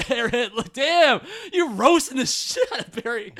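loud, high excited outburst. Real, not acted. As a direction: shout out in delight. Reacting to the roast.

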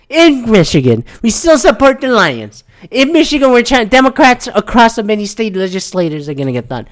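mocking the governor's upbeat address; mock Whitmer speech. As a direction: parody a governor's rally tone.

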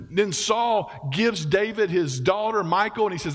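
ordinary talk

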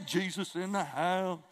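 a hint of a modern club announcement; modern-day slang. Shown as a demonstration. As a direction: say "Jesus in the house" like a party shout-out, lightly. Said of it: "that's a modern day".

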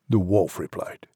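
ordinary delivery

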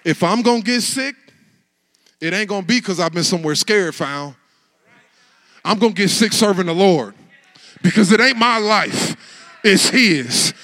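loud defiant preaching; full-force preaching. Acted. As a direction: preach it with everything.

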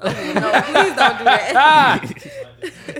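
loud, drawn-out laughing protest; loud laughing plea. Real, not acted. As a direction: stretch it out loud through laughter. Laughing plea at fire joke.

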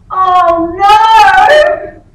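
loud, high acted wail; hammed-up howl of dismay from next door; mock cry of dismay. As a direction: imitate a daughter crying out in dismay. Daughter's cry from next changing room.

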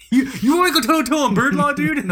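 high, loud, fast mock challenge; jokey high-pitched outburst. Mock challenge over bird law.

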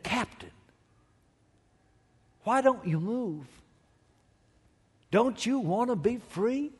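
put-on southern accent. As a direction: gently coax the captain in a mild southern accent. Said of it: "And with that southern accent".

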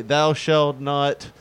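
slow, grand mock scripture voice; joking that the Bible forbids chair rocking, solemnly slow; mock biblical. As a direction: intone it like old King James verse.

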